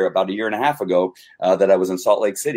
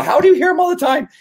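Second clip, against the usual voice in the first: much higher and louder, quick and astonished; amazed question; 5 dB louder, faster than usual. Retold or imitated. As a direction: ask in disbelief, almost squeaking. Asking friend, acted quote.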